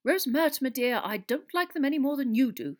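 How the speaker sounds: understated unease in an official's protest; uncomfortable. A narrator's reading. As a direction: placate the landlady a little uncomfortably. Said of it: "said Fudge uncomfortably".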